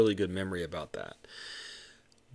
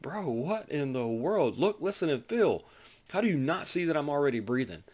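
high, incredulous retelling; exasperated at the trainee; upset; 6 semitones higher than usual. Retold or imitated. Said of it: "I was so upset with him".

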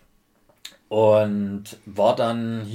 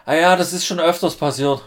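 relaxed operator; 7 semitones higher, 8 dB louder than usual. Retold or imitated. Operator retold.

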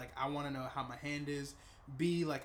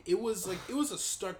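shocked; 6 semitones higher, 9 dB louder than usual. Real, not acted. Shocked at blood pressure reading.

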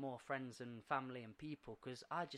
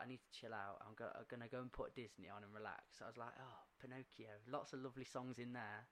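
winding-down self-talk; 4 semitones lower, 10 dB quieter than usual. Retold or imitated. Retold tired thoughts before relaxing.

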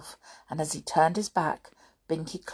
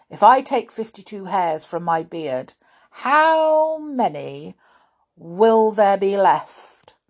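stern schoolmaster; 8 dB louder than usual. A narrator's reading. Grimm warning and quizzing Binky.

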